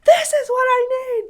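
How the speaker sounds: very high, loud whiny shout; mock tantrum shout. Mom imitating daughter's demanding screams. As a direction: shout like a little dictator.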